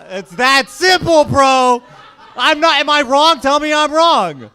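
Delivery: loud, high, insistent shouting; shouted rant. Acted. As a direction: holler it as if demanding agreement. Heated rant to audience.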